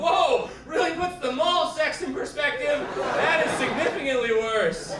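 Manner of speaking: high, loud stunned reaction; mock shock. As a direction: react hammed-up, as a shocked viewer. Shocked viewer reacts to headline.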